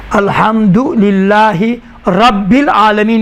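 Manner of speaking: الحمدللہ read slowly and deliberately, each syllable clear; slow demo reading. Shown as a demonstration. Teacher reading الحمدللہ slowly to show students phrase-by-phrase recitation. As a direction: spell out الحمدللہ slowly for dictation. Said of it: "جملہ بے جملہ آرام سے تلفظ کرنا".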